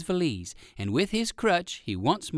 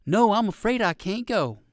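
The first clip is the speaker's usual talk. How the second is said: put-on higher, polite old gentleman rabbit; 7 semitones higher, 4 dB louder than usual. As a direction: decline kindly as an old rabbit. Uncle Wiggily voiced higher.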